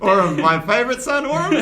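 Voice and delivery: a tipsy father fumbling his son's name; tipsy dad. Acted. Tipsy dad forgetting his son's name, laughing. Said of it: "and he says".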